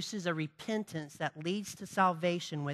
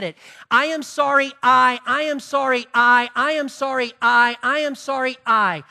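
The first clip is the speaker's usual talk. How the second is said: higher, louder whining "I am sorry" voice, building; hammed-up insincere sorry, growing more frantic; mock self-pitying apology; 5 semitones higher, 8 dB louder than usual. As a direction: act out a cornered sinner pleading. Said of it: "worldly grief goes something like this".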